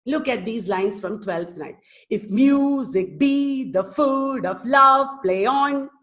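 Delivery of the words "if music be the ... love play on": slow chanted line, stressing "mu", "be", "food", "love", "on"; almost sing-song weak-strong beat, holding "food" and "love"; iambic stress. Shown as a demonstration. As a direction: exaggerate every second syllable, as in "music BE". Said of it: "I'm showing you the stress".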